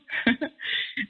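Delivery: soft breathy laugh after the cheer; airy laugh. Real, not acted. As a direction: let out a quiet airy laugh.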